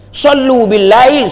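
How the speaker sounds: drawn-out chanted hadith; slow Arabic recitation. Acted. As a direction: intone the Arabic slowly and reverently.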